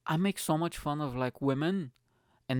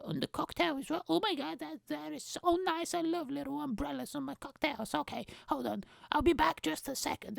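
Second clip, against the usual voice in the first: put-on high gushing girly voice; gushing girl; 14 semitones higher than usual. Girl thanking the guy for the cocktail, high gushing voice. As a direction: gush over the umbrella.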